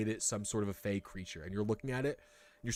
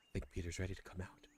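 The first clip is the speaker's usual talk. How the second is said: hushed aside; 5 semitones lower, 13 dB quieter than usual. Acted. Character listens at the egg.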